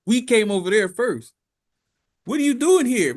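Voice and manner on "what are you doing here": higher, louder demand; hammed-up human outrage; mock indignant. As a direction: challenge the intruders. Humans confront alien visitors.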